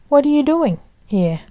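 lightly voiced stern father, high-pitched question; fatherly. A narrator's reading. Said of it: "with an air of a father".

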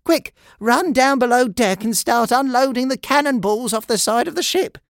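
high, urgent calling voice; first mate's shout. A narrator's reading. First mate stops George going overboard, urgent shouting. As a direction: call out urgent orders to a crewmate. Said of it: "shouted First Mate Steve".

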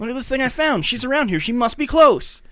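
thrilled she's nearby; excited discovery. Retold or imitated. Zia shows friends the paper with her address, excited.

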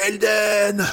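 high anguished cry of a name; screamed name. Acted. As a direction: scream her brother's name. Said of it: "pour hurler". (cri déchirant, aigu)

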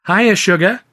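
high, loud flirtatious call; flirty greeting. A narrator's reading. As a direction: sing out a saucy American hello.

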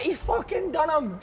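high, drawn-out mock jeer; high and slow, imitating someone in hysterics; retold gleeful shout. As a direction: imitate a delighted onlooker crowing. Retold jeer from laughing onlooker.

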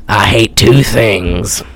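low, slow villain voice; mock killer. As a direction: drop deep and draw it out like a hammed-up killer. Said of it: "The killer".

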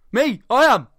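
high, loud short bursts; mock outraged shout. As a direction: imitate an exasperated yell. Driver's exasperated shouted answer.

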